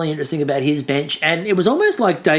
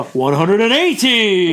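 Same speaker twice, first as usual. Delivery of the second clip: darts caller; 3 semitones higher, 4 dB louder, slower than usual. Retold or imitated. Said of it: "warming up for the".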